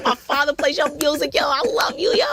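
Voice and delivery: high, eager fan gushing at a celebrity; gushing fangirl. Retold or imitated. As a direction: lay on the starstruck praise. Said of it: "so fangirl".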